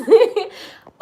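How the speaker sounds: loud high laugh. Real, not acted. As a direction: burst out laughing. Laughs after begging.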